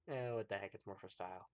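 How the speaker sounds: softly tossed-off celebrity quote, fading away. Retold or imitated. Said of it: "That's what [name] says".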